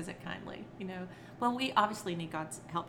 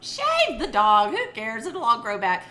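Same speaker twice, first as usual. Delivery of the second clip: loud, slow, dismissive shout; mother fed up with the matted dog; exasperated; 4 semitones higher, 12 dB louder than usual. Retold or imitated. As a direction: wave it off loudly. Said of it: "And I was like".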